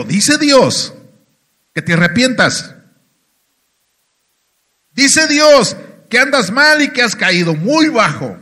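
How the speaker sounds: loud, high prophetic declaration; retold prophetic rebuke. Young man prophesying over a backslidden believer, loud retold rebuke.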